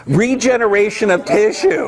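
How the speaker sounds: high-pitched, amazed cheer for effect; hammed-up excitement. Shown as a demonstration. Lecturer prompting the audience for a better reaction, hammed-up excitement. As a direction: sell it as thrilling news. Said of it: "that's not the reaction I'm looking for".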